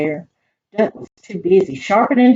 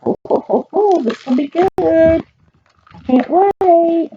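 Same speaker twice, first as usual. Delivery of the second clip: excited; 9 semitones higher, 3 dB louder, slower than usual. Acted. Reader's excitement about story.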